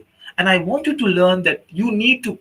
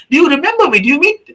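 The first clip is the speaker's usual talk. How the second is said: eager greeting; 8 semitones higher, 5 dB louder, faster than usual. Retold or imitated. Man eagerly greeting the sheikh.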